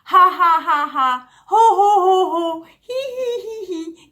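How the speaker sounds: high, loud fake ha ha then hee hee; forced laughter. Shown as a demonstration. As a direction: fake it till you make it, laughing on cue. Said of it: "You might have to go".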